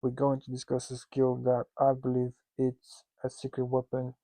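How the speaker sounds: ordinary delivery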